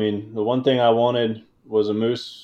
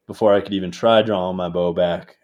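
ordinary delivery

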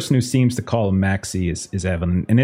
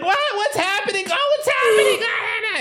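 much higher, frightened cries fading away; panicked woman. Acted. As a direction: scream as she's dragged off. Woman dragged off the boat.

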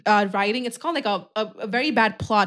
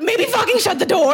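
high, incredulous outburst with a laugh; amused exasperation; 7 semitones higher, 3 dB louder than usual. Real, not acted. Friend scolds careless TV characters, exasperated.

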